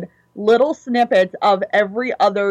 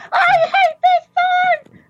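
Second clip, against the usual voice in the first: much higher, louder raging yell; furious shout; 4 dB louder than usual. Retold or imitated. Anger raging at the jingle, high furious shout. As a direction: scream it like flames are shooting out of your head. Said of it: "He's like".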